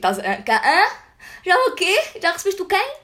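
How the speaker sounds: high, puzzled questions; acting totally lost, rising in disbelief; confused disbelief. Her own confused reaction, acted. (perguntas confusas, incrédula)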